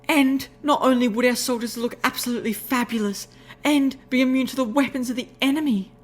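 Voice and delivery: high, excited lady's voice; rapturous. Acted. Rarity selling her idea to Applejack, high rapturous voice. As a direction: gush with excitement, pitched high. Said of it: "glowing in the rapture".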